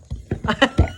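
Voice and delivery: short sheepish giggle; embarrassed laugh. Real, not acted. Storyteller laughing at her own awful accents. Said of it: "That was some awful accents".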